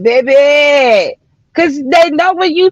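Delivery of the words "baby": put-on sharp high call of Baby to testing daughters; warning shout. Mother warns her testing daughters, calling Baby out loud. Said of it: "I be like".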